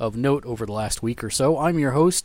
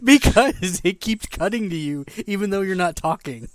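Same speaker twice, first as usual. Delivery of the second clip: higher-pitched, laughing explanation; 5 semitones higher than usual. Real, not acted. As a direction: explain through real laughter. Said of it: "we're laughing at".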